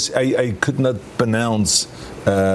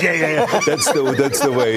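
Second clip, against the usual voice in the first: high, excited approval with a laugh; imitated fan getting what they wanted; delighted fan; 7 semitones higher than usual. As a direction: burst out in quick, thrilled agreement. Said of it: "one fan came up".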